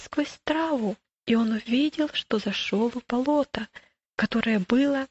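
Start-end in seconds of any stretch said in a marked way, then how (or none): none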